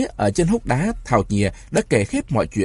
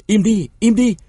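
shouted much higher and louder; angry shout; 11 semitones higher, 5 dB louder than usual. A narrator's reading. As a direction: bark it to silence the crowd. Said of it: "Những tiếng quát". (quát to, giọng cao vút)

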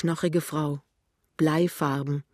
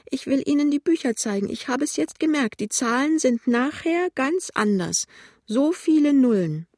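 put-on high, agitated old woman's lament; pitiful; 10 semitones higher, 5 dB louder than usual. A narrator's reading. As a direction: wail it high, bewildered. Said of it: "sagt die alte Frau kläglich". (klägliche, hohe Altfrauenstimme)

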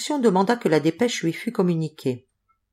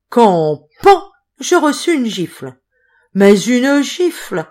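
higher and louder, dragging out une gifle; indignant outburst; 4 semitones higher, 6 dB louder, slower than usual. A narrator's reading. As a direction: relive the slap with outrage. Clerk furiously retelling the slap.

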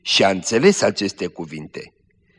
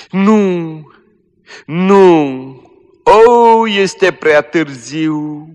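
high, loud, very slow despair; dying girl wails in despair, high and slow; anguished wail; 8 semitones higher, 7 dB louder, much slower than usual. Acted. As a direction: wail that it is too late.

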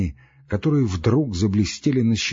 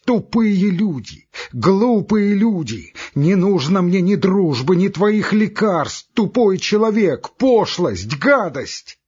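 high, drawn-out tirade of insults; furious shouting; 9 semitones higher, 4 dB louder, slower than usual. A narrator's reading. Doctor abusing his fleeing visitors, furious shouting. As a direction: hurl each insult loudly, voice way up. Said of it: "продолжал кричать Андрей Ефимыч".

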